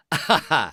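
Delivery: brief amused chuckle, falling; a quick laugh at the situation; short laugh. Acted. Laugh alone on a line.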